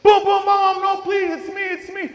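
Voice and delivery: high, loud calls to Mom through the door; desperate pleading shout. Retold or imitated. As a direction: beg her to open up, shouting. Boy banging on door, begging.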